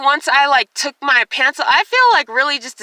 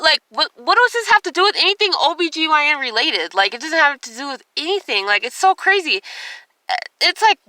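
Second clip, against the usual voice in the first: ordinary talk, nothing marked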